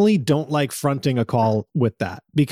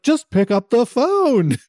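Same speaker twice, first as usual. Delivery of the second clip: exasperated mock plea; 10 semitones higher, slower than usual. Mock reply to Siri.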